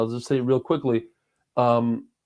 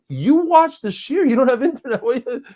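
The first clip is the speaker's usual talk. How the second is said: mock quote; 10 semitones higher, 4 dB louder than usual. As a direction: ham up the guy's teasing line. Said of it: "He said".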